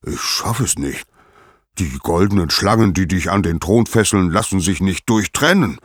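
mounting, forceful outburst of a helpless god; thundering. Acted. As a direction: thunder in frustration as an acted Zeus. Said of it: "donnerte Zeus".

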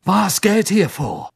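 high slow agitated demand; anxious demanding question. A narrator's reading.

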